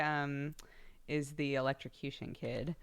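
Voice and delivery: ordinary talk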